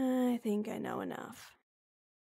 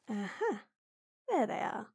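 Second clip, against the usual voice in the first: normal talking voice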